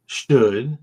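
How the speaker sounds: ordinary delivery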